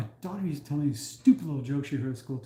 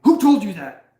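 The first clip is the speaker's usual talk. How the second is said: loud, high, startled question; half-laughing disbelief, loud; mock shocked dad; 5 semitones higher, 12 dB louder than usual. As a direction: react like a dad caught off guard. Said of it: "and I go".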